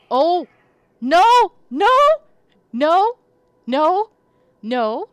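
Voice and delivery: high, very loud panicked screaming of no; terrified screams. Real, not acted. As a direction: scream in real fright. Jump scare while streaming.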